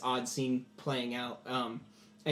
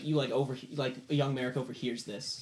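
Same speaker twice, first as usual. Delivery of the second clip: ordinary talk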